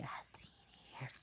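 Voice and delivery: soft whisper aside. Real, not acted. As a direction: whisper it to the listener. Aside to listener.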